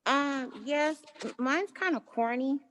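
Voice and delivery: muffled behind a face mask; talking through a mask. Real, not acted. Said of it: "let me take my mask off".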